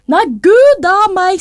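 high-pitched exclamation of dismay; shocked mother. A narrator's reading. Mother hears what the king did, high shocked voice.

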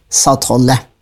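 ordinary delivery

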